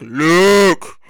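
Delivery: low, slow breathy Vader voice with heavy mask breaths; slow deep menacing villain with sighing breaths; Darth Vader impression. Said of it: "the Darth Vader effect?".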